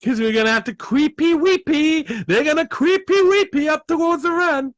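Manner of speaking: high mock spooky voice; high creepy teasing croon; spooky sing-song.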